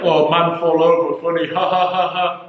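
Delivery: spoken fake laugh, hammed up; mock simpleton amusement. Mocking partner's taste in simple jokes, mock-dim amusement.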